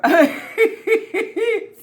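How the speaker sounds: a burst of laughter she can't hold in; high burst of laughter. Real, not acted. Giggling woman on camera bursts out laughing, loud and high.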